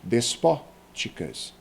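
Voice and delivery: "Despóticas" slow, punching PÓ; antepenultimate stress. Shown as a demonstration. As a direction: break after PÓ in "Despóticas". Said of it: "são sempre proparoxítonas". ("Despóticas" devagar, com PÓ bem marcado)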